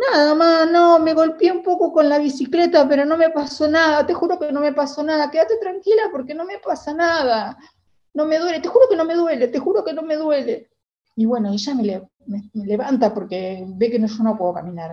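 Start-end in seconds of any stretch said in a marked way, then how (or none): 0.1-10.7 high, loud, tearful insistence; pleading reassurance. Retold or imitated. Hurt girl swearing to her crying mother she is fine, high tearful pleading.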